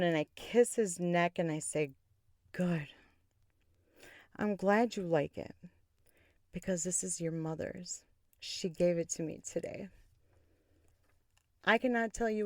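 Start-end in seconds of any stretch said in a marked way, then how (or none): 2.5-9.9 soft, hushed reveal; quiet bedroom tease. Retold or imitated. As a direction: drop the bombshell softly into the ear.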